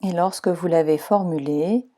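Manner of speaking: calm, even meditation voice guiding relaxation; guided meditation. Acted. Leads Yoga Nidra relaxation.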